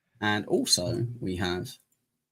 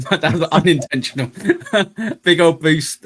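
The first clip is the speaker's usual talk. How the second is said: laughing; 6 semitones higher, 9 dB louder than usual. Real, not acted. Laughs at own accidental pun.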